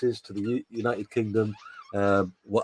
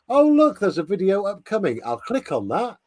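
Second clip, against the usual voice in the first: mock excited; 8 semitones higher, 4 dB louder than usual. Mock tutorial for Snowy.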